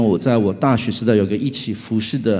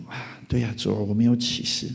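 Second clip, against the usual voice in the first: sheepish admission; 8 dB quieter than usual. Retold or imitated. Quiet admission to God.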